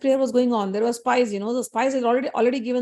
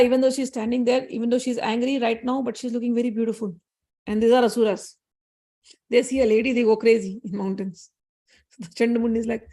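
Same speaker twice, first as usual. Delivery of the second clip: ordinary delivery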